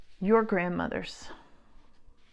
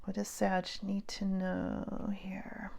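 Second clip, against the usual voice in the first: hushed, slow whisper to the cards; whispered question; 7 dB quieter, slower than usual. Real, not acted. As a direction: ask the cards under her breath.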